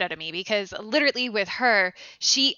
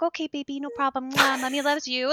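softer, mocking baby talk to a husband; a hint of a coddling mommy; imitating Lady Macbeth soothing her husband, softly; soft coddling mother; 5 dB quieter than usual. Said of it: "And then she's just so soft".

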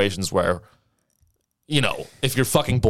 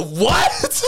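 disbelieving what; 11 semitones higher than usual. Retold or imitated. Hears boyfriend is deploying.

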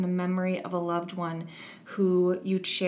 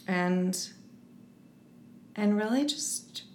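guided visualization; 5 dB quieter than usual. Acted. Leads a short visualization.

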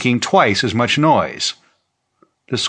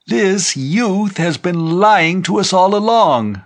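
high, slow, ringing denunciation; crying out in accusation; 9 semitones higher, 4 dB louder than usual. A narrator's reading. Bearded old man accusing the youth, crying out high. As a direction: denounce him like a wrathful preacher. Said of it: "the old man with the beard cried".

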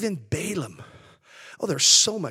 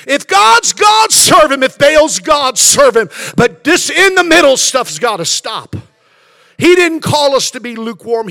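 loud, much higher shouted sermon; full-force preaching; 5 semitones higher, 7 dB louder than usual. Acted. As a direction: thunder Elijah's challenge. Preaching rises again to shouting.

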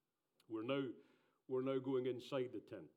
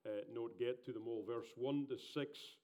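ordinary talk

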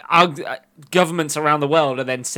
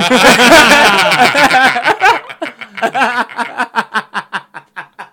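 long, loud villain cackle; evil laugh; 6 semitones higher, 6 dB louder than usual. Pretend. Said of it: "That was an evil laugh".

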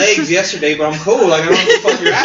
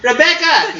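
high, drawn-out call to a friend; 8 semitones higher, 4 dB louder, slower than usual. Retold or imitated. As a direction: imitate a girl calling across the room. Retold stripper calling across room.

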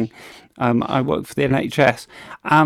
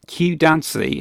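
usual speaking voice

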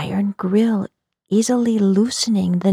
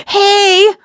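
sharp high loud yell; 13 semitones higher, 11 dB louder than usual. Acted. Narrator yelling at the chasing cat, loud high shout. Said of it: "I yelled".

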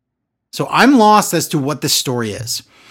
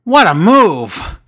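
loud, drawn-out commentator yell; slow, booming mock call; imitated yell; 3 semitones higher, 6 dB louder, much slower than usual. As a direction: mimic an announcer calling out, stretched slow. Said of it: "he yells".

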